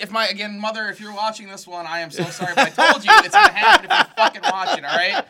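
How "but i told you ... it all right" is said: high, loud laughing warning; laughing outburst. Real, not acted. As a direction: burst out laughing as you say it. Apologizing to mom, laughing.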